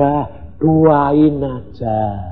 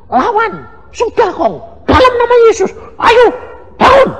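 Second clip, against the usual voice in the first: granddaughter voice; 6 dB louder than usual. Retold or imitated. Granddaughter quoted telling herself.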